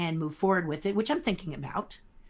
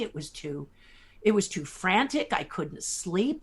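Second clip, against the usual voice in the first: ordinary delivery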